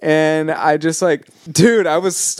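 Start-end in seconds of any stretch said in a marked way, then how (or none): none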